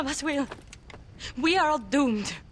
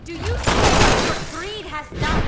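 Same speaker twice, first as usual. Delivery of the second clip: louder accusing reproach; 2 semitones higher, 6 dB louder than usual. Acted. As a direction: throw the blame at him. Woman scolds the dead man.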